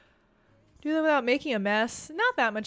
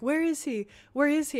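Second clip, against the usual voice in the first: higher, louder retold search call; mock frantic calling; 6 semitones higher, 5 dB louder than usual. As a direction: call out as if hunting for a lost friend. Retells searching for lost friend.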